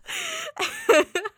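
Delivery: a sudden shrill squeal of laughter; high-pitched giggling squeal. Real, not acted. Laughing at her own joke.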